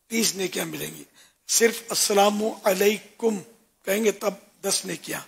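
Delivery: ordinary talk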